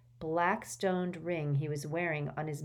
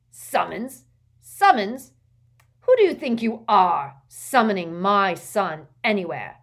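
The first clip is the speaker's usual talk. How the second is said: outraged; 3 semitones higher, 10 dB louder than usual. A narrator's reading. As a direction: explode in indignation after repeating the word. Father outraged at summons of his son.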